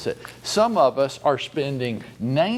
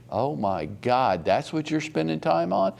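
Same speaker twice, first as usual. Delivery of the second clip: ordinary delivery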